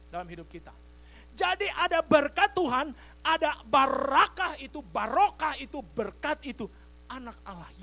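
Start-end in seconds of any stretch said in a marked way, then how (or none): 1.3-6.7 loud, high, emphatic proclamation; preaching at full force. Real, not acted. Preacher assures congregation of God's blessing, forceful preaching.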